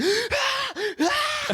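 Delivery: very high, full-body guttural barks; guttural dog bark. Retold or imitated. Imitating her dog seeing the brown cat, guttural shrieking bark. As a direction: bark ballistically from the whole body. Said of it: "a more high-pitched, like, full body guttural".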